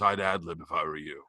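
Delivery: low, quiet deadpan aside; understated low-voiced veteran actor; Elliot Gould. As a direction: drop it low and soft, an offhand tip from behind. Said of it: "And Elliot Gould just goes".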